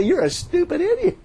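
high, scornful imagined jeer; mock scoff. People scorning someone digging for a shirt, high mock jeer. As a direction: sneer it like a bystander.

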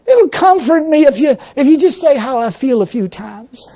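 high, whining self-pity; whiny flesh. Retold or imitated. Preacher mocking his flesh to the congregation, high and whining. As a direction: whimper like a spoiled child wanting comfort. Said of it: "That's the flesh".

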